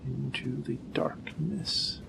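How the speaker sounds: hushed spooky whisper. Pretend. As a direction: breathe it like a ghost story. Spooky aside about going down.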